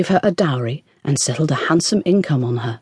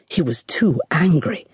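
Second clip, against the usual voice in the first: usual speaking voice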